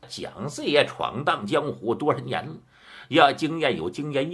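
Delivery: ordinary delivery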